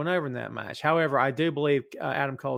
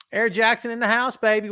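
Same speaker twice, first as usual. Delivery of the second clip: hype shout-out; 7 semitones higher, 5 dB louder than usual. Real, not acted. Hyping a chat viewer.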